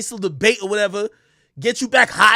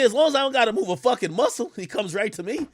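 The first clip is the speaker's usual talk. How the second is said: ordinary talk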